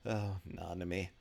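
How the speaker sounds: ordinary talk, nothing marked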